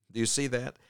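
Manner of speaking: normal talking voice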